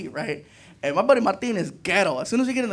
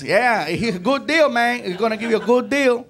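Puerto Rican clerk; 5 semitones higher, 4 dB louder than usual. Retold or imitated. Juan pushing the deal.

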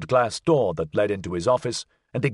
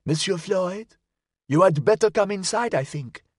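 put-on high-pitched elderly Frenchwoman; high, put-on voice of an old Parisian neighbour; elderly French woman; 8 semitones higher than usual. A narrator's reading. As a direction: urge him in with a high old lady's voice. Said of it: "An elderly woman pushed her powdered face".

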